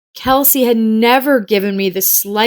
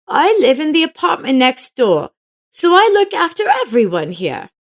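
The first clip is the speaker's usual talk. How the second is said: put-on elderly neighbor woman; 9 semitones higher than usual. Old neighbor woman speaks.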